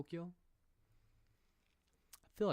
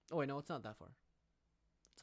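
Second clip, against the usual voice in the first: ordinary talk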